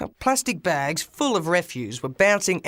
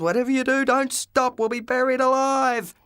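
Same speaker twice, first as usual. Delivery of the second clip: high urgent yell; high frantic yell at the driver to keep going; 8 semitones higher than usual. Acted. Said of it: "I yelled".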